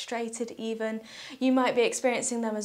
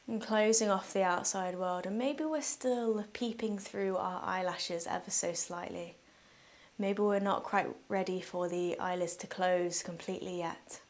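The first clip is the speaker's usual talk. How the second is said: soft, unhurried meditation voice with long pauses; guided meditation; 2 semitones lower, 6 dB quieter than usual. Real, not acted. Yoga teacher guiding listeners through breathing, calm guided meditation.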